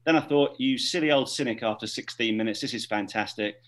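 ordinary talk

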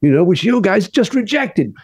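high, bold sales pitch; pushy manager. Retold or imitated. Manager pushing [name]'s script on [name], high and bold. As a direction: put the studio head on the spot. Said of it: "And he goes".

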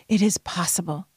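whispered encouragement; gentle whisper. Real, not acted. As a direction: breathe it as a secret. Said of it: "whispered encouragement".

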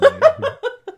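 a big real burst of laughter, pitch jumping high; hearty laugh. Real, not acted. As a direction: laugh out loud at your own joke. Laughs at own joke.

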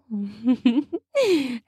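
soft amused chuckle; light chuckle. A narrator's reading. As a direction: give a small teasing chuckle. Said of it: "she says with a chuckle".

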